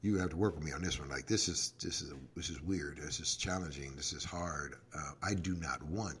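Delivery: honest prayer dropping to near silence; quiet prayer. Retold or imitated. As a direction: murmur the struggle as a private prayer. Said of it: "stop and pray and say".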